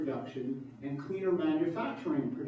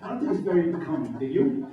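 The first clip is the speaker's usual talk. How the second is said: a lightly put-on New York accent, fast; playful New York accent, quick and a bit louder; 4 dB louder, faster than usual. Shown as a demonstration. Former New Yorker teasing the audience, light New York accent. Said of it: "I could talk like this".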